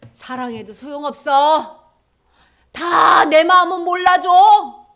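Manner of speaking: loud, drawn-out wail of grievance; bitter complaint. Acted. Jesus imagined complaining of betrayal, loud aggrieved. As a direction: build to a slow, aggrieved shout.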